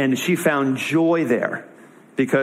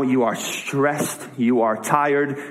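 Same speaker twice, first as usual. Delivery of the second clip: slow, deliberate; slower than usual. Retold or imitated. Jesus naming Martha's stress.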